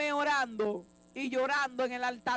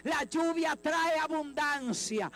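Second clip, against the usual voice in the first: fiery preaching; 4 semitones higher than usual. Acted. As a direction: preach it out to the whole church at full force. Preacher rising to full force.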